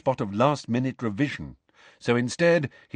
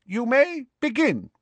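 put-on elderly examiner. A narrator's reading. Old examiner starting the exam for the hall, reedy call. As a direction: announce it to the whole hall like an ancient examiner.

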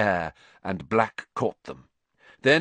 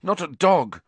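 moaned; 7 semitones higher, 4 dB louder than usual. Acted. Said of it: "Ron moaned".

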